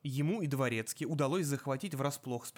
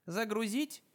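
higher metallic machine voice; a speaking gadget, metallic and rising; металлический голос; 8 semitones higher, slower than usual. A narrator's reading. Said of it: "раздался металлический голос из динамиков". (металлический голос машины)

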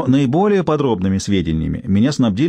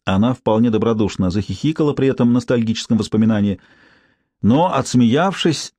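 ordinary delivery, nothing marked

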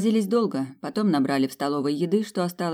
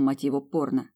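ordinary delivery, nothing marked

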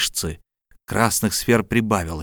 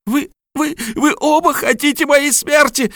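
high, shrill panicked cry; hysterical cry; 4 dB louder than usual. A narrator's reading. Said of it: "вскричала Хелена".

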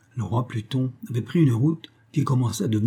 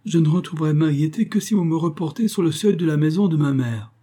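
despairing plea; 6 semitones higher, 4 dB louder than usual. Acted. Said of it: "au comble du désespoir".

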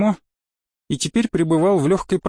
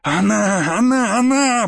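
repeated like a prayer, pitch climbing; high, drawn-out ecstatic repetition; rapturous joy; 5 semitones higher, slower than usual. Acted. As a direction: exult with reverent, swelling delight. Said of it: "повторил он несколько раз как молитву".

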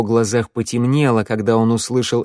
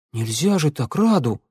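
higher, dismayed plea; gasped protest; 4 semitones higher than usual. A narrator's reading. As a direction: gasp out the reproach. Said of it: "ахнула Санда". (ахнув, с укором)

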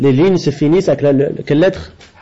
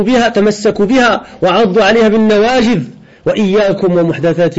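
raised, measured reading voice; Arabic commentary reading; 4 semitones higher, faster than usual. Acted. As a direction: read the scholar's text out to the class. (lecture à voix haute, ton élevé, du commentaire arabe)

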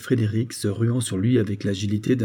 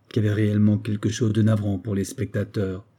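usual speaking voice

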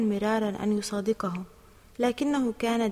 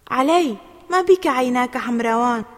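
louder, concerned question; worried; 7 dB louder than usual. A narrator's reading. Mother asking Ali about his red eyes, worried. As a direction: ask with a touch of alarm. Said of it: "وسألته أمه بقلق".

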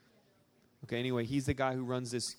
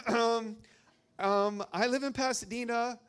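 nervous high voice; 10 semitones higher, 5 dB louder than usual. Retold or imitated. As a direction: voice pitched higher from nerves. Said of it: "my voice gets higher and higher".

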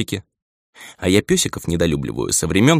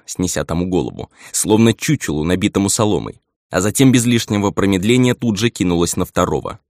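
usual speaking voice

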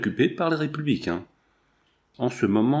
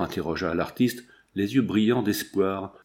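usual speaking voice

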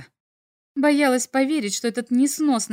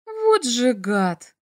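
plain reading voice

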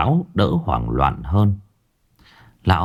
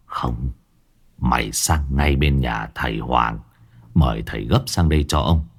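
weary refusal; 8 semitones lower than usual. Acted. Exhausted old master sending Tý for the sorcerer, weary. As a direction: shake your head, too worn out to argue. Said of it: "lắc đầu mệt mỏi".

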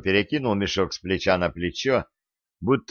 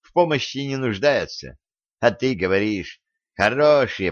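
usual speaking voice

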